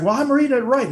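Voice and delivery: very high, defensive mock protest; acted panicky retort. Co-host playing Hudson defending his tracker reading, defensive. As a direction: protest in a squeaky-high pitch.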